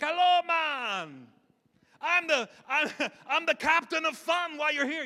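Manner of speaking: put-on booming high-pitched cruise host with a Jamaican accent; mock Jamaican emcee, laughing through a loud greeting. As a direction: holler it over the mic in a hammed-up Jamaican accent. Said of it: "he has a Jamaican accent".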